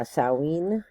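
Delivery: Samhain said "SAH-een" with a nasal ending; Samhain pronounced SAH-een; alternative pronunciation. Shown as a demonstration. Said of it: "which can also be pronounced".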